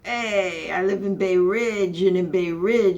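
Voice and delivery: put-on loud Italian-American Bay Ridge accent; Italian Brooklyn accent. Bay Ridge local joking about neighbors, loud Italian accent. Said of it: "you gotta put on the Italian accent".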